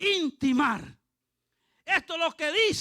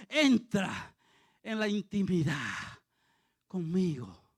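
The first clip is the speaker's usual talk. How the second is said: low, slowing voice sinking at the end; God's intimate invitation; 7 semitones lower than usual. Acted. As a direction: draw the prophet gently closer. Acts God inviting Jeremiah into intimacy.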